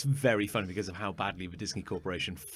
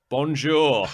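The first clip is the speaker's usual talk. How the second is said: overdone French greeting, louder; hammed-up French bonjour; mock French accent; 3 semitones higher, 7 dB louder than usual. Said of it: "he goes like".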